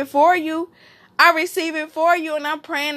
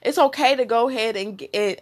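ordinary talk, nothing marked

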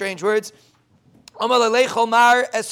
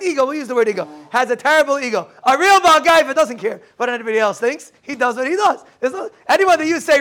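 ordinary talk

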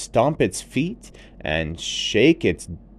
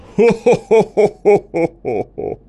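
high loud happy laugh; big gleeful laugh; tiger laughing; 10 semitones higher, 6 dB louder than usual. Acted. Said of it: "fell over laughing".

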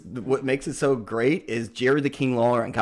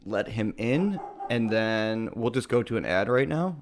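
ordinary talk, nothing marked

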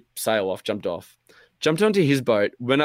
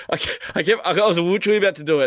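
laughing; 8 semitones higher, 5 dB louder, faster than usual. Real, not acted.

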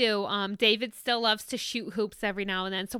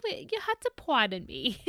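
ordinary talk

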